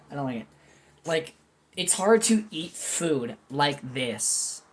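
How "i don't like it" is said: repeated softly through a little laugh. Real, not acted. Admitting to viewers she feels like bragging, softly laughing. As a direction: laugh it off quietly.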